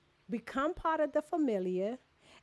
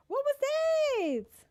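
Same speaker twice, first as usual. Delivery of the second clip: high, slow startled question; surprised; 12 semitones higher, 6 dB louder, much slower than usual. Real, not acted. Streamer startled by a chat name, slow high surprise. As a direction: stretch it out in disbelief. Reacts to an odd chat message.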